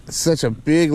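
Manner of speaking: normal talking voice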